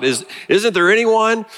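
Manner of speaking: put-on high, pleading cry of a frustrated kid; frustrated boy calling out for an answer; Charlie Brown crying out. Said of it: "he cries out".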